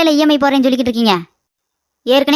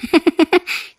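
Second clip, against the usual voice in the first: mocking laugh; 4 semitones higher than usual. Aunt gloats over the quarrel.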